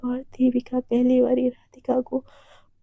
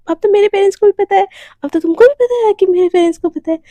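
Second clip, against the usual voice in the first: excited announcement; 5 semitones higher, 6 dB louder, faster than usual. Acted. Radhika excitedly announcing to Devika.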